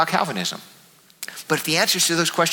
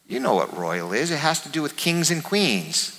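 ordinary talk

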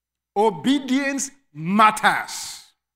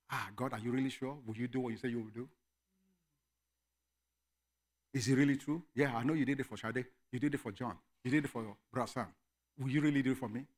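much softer, hushed questioning; doubting believer; 9 semitones lower, 11 dB quieter, faster than usual. Retold or imitated. Struggling believer questioning God, hushed doubtful plea. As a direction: plead quietly like someone unsure of God. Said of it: "The reason we struggle to obey".

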